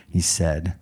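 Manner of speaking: ordinary talk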